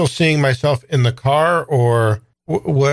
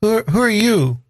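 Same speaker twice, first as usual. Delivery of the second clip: put-on much higher, stammering child voice; frightened young boy; 10 semitones higher than usual. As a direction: ask haltingly as a scared little boy.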